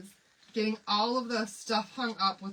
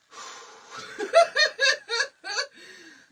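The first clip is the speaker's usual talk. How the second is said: high, loud half laugh half cry; a desperate laugh at the chaos; laughing groan; 13 semitones higher, 9 dB louder than usual. Real, not acted. To viewers about the ramp under the truck, laugh-cry.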